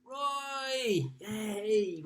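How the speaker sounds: high, drawn-out cheer; excited cheer. Real, not acted. Host greeting Roy's quiz score, high celebratory cheer. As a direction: cheer the name like a fan. Cheers the name just read out.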